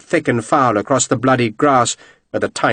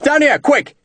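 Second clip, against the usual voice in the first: urgent shout; 13 semitones higher than usual. A narrator's reading. Lieutenant shouting urgently for the redcoats to come quickly. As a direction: yell again, urging them to hurry. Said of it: "he shouted".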